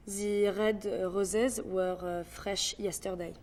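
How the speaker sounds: slow French accent with a filler pause. Shown as a demonstration. Breaking down how to do it, French accent. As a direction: take it slowly with French R's. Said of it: "how to do a French accent".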